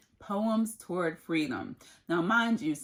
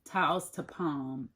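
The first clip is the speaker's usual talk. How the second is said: poem reading. Acted. Reads a poem aloud.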